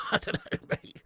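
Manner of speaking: normal talking voice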